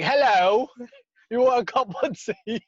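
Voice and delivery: high-pitched mock British greeting; British accent. Shown as a demonstration. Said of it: "My British accent is okay".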